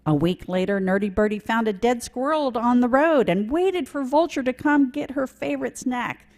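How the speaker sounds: ordinary talk, nothing marked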